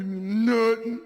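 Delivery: mock a sulky son's long, loud non-answer; drawn-out kid's brush-off; uncommunicative son. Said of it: "my son do not talk".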